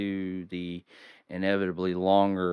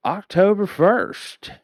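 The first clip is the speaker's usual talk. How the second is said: dramatic announcement; 11 semitones higher, 7 dB louder, slower than usual. Acted.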